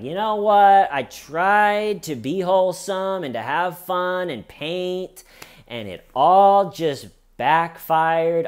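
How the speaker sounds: high, drawn-out poor-little-me lament; mock self-pitying attitude. Suspect says fun backfired on her, mock self-pity. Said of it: "She takes this attitude like".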